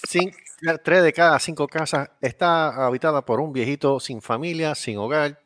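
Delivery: cheesy charity ad voice; mock commercial read. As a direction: sell it like a TV charity appeal.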